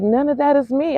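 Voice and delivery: lifted incredulous repeat. Retold or imitated. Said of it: "I was like".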